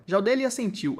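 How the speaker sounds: ordinary delivery, nothing marked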